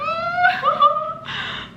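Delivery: very high giggly squeal. Real, not acted. Fan spotting a favourite character, squealing. Gushing over a beloved character.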